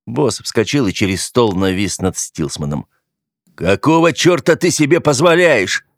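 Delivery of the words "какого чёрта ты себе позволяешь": sharp furious question; angry outburst. A narrator's reading. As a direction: snap at him in anger. Boss explodes at Stillsman. (гневный выкрик)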